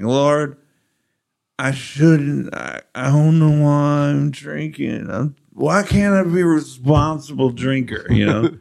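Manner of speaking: put-on drunken prayer. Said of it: "I would get drunk and be like".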